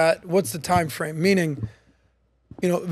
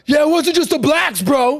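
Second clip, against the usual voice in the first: higher-pitched, indignant imitation of a guy arguing; MAGA guy; 7 semitones higher, 3 dB louder than usual. Retelling an argument with a hardcore MAGA guy, indignant imitation. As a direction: throw it out like an aggrieved bro.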